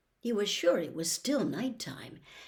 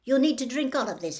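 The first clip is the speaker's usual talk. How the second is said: higher, louder, quick matron voice; brisk nurse; 6 semitones higher, 6 dB louder, faster than usual. Acted. Nurse hands Harry the potion, brisk and high. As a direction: bustle it out like a busy nurse.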